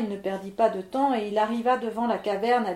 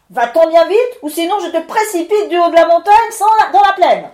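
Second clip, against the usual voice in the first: stentor's cry; 6 semitones higher, 14 dB louder than usual. Acted. Levent roaring at the miller to go away, booming stentor shout. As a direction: bellow like a furious storm spirit. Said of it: "il lui cria d'une voix de stentor".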